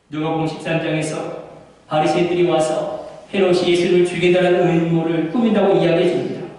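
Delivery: usual speaking voice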